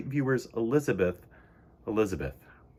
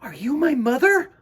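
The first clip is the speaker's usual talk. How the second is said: little bird crying out; 9 semitones higher, 7 dB louder than usual. Acted. Choco calling to Missus Walrus, high and loud. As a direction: call out with hope. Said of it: "he cried".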